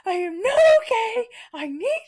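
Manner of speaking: high-pitched, loud wailing meltdown; re-enacted shrieky distress; retold screaming. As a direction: wail it in a hammed-up panic, pitch way up.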